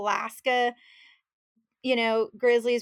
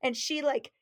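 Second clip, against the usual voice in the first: ordinary delivery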